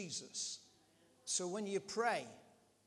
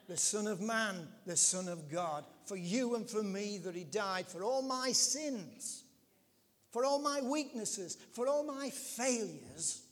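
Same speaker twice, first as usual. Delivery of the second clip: loud preaching, building higher; raised voice, hammering each repeated phrase, slowing at the end; impassioned preaching; 4 semitones higher, 7 dB louder than usual. Real, not acted. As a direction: build to a fervent sermon climax.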